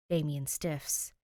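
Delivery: usual speaking voice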